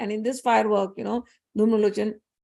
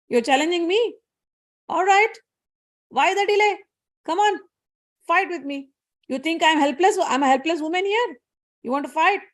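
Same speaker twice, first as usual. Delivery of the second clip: much higher, taunting dare; defiant challenge; 6 semitones higher than usual. Acted. Devi taunts Dhumralochan to fight her, high defiant dare. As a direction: goad him into fighting. Goddess taunts the demon general.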